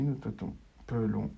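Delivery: ordinary talk, nothing marked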